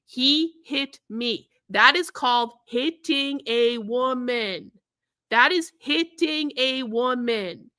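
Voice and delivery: slow, separated words on "hitting a woman"; deliberate, drawn-out stress on each word of "hitting a woman"; emphatic spelling-out. Real, not acted. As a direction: land "He hit me" word by word. Spells out the assault to viewers.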